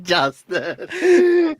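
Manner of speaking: high, laughing repeat of Justin; amused echo. Real, not acted. Echoing the friend's wrong name, laughing. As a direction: echo it in delight.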